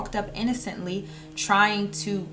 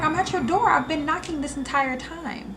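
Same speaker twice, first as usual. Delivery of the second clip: friend's voice; 8 semitones higher, 3 dB louder than usual. Retold or imitated. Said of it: "She's like".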